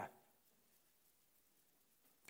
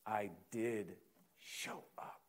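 fading whisper; 3 semitones lower, 8 dB quieter, slower than usual. Acted. Jesus's quote fades to a whisper.

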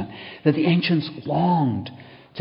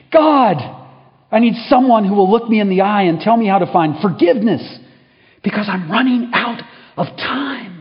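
much higher, loud anguished plea; anguished cry; 9 semitones higher, 7 dB louder than usual. Acted. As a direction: cry out for answers. Dying man's final plea.

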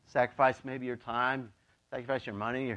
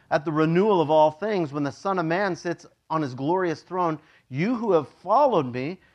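scripture reading; 4 dB louder than usual. Acted. Pastor reads the words of Jesus to his congregation, measured and raised. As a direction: read it out with weight to the church.

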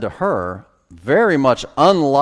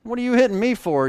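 mock angry retort, pitched up; lost temper; 11 semitones higher than usual. Preacher paraphrases Paul after the slap, mildly angry. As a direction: act out an indignant comeback. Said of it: "He lost his temper".

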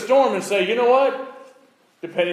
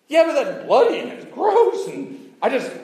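disgusted whine; 9 semitones higher than usual. Retold or imitated.

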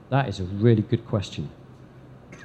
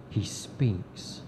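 hushed whisper of he speaks; whispered reveal to the congregation; 3 semitones lower, 9 dB quieter than usual. Acted. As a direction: drop to a bare whisper.

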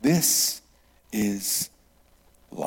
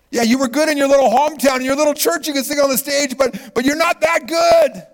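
loud, high-pitched rapid rant slowing at the end; exasperated high-pitched shout; mock blunt put-down; 14 semitones higher, 8 dB louder than usual. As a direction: shout it like a blunt reality check. Mock blunt verdict on contestants.

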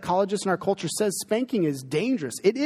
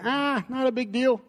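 high, dismissive mock dad; mimicking dad; 8 semitones higher than usual. Dad undercutting mom in front of kids, high dismissive mock. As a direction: brush it off like a lax dad. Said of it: "dad saying".

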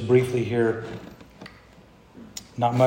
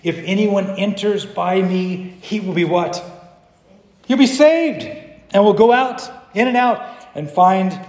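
higher, building to a loud cry; preaching scripture; 7 semitones higher, 5 dB louder than usual. Acted. Preacher quotes Jesus' promise to the church, exultant proclamation. Reads verse, climbing to shout.

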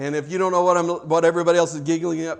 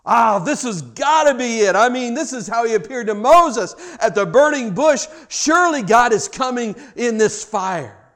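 raised, louder eager voice; hopeful excitement, pitched up and loud; 6 semitones higher, 7 dB louder than usual. Acted. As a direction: voice the prophet sure it's God at last. Elijah expecting God in fire.

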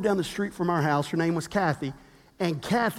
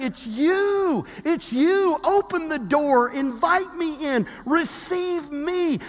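God's gentle call; 7 semitones higher, slower than usual. Retold or imitated. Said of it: "God saying".

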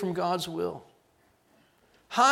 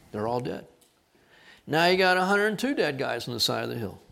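ordinary talk, nothing marked

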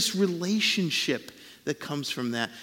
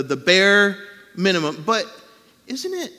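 usual speaking voice

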